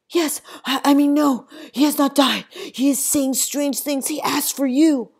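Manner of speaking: high, loud, breathless rush of words; panting from the dash to the river; out of breath. A narrator's reading. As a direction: gasp it out after running. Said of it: "I am panting out of breath".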